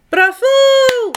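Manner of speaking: high loud proud bravo. A narrator's reading. Giraffe praises Egbert, high proud bravo. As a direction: cheer triumphantly. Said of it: "zegt giraf trots".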